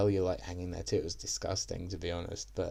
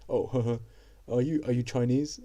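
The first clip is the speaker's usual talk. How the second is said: mock firefighter; 4 semitones higher, 3 dB louder than usual. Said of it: "The interaction went".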